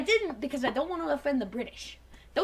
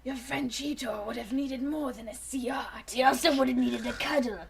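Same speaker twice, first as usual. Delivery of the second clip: Dinobot whisper; 4 semitones lower, 6 dB quieter than usual. Acted.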